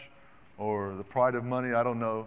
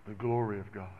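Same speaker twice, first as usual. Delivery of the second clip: quiet worship; 5 semitones lower, 13 dB quieter than usual. Real, not acted. Preacher to God and the church, hushed reverent praise. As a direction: lower and softer, reverent murmur.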